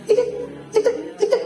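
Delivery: very high squeaky cek cek, like an ECG blip; high heart monitor beeps. Shown as a demonstration. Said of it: "E K G ya?". (bunyi monitor jantung melengking tinggi)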